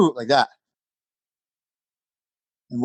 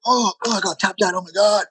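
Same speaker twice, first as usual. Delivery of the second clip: higher, hammed-up whine of defeat; mock dismay; 7 semitones higher than usual. Imitating guys upset after getting tapped out, whiny. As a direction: imitate a guy crushed by a tap. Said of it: "I've seen guys go".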